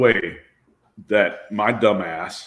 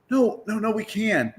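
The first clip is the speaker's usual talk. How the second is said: much higher, pleading comeback; retold eager contradiction in raised pitch; high-pitched insistence; 10 semitones higher than usual. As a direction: insist it can be done, voice jumping up. Retold insisting against the refusal.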